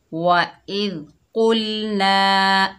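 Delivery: slow, drawn-out tajweed recitation; measured recitation demo; Quranic recitation. Shown as a demonstration. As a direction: chant it slowly like a Quran teacher. Quran recitation teacher demonstrates.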